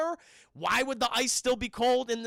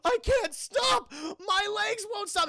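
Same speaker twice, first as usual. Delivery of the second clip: high, loud, slow mock wail; helpless panic; 13 semitones higher, 5 dB louder, slower than usual. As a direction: wail it out in hammed-up desperation. Thompson helpless in haunted game.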